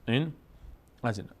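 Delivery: ordinary delivery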